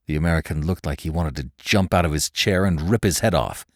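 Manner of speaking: ordinary delivery, nothing marked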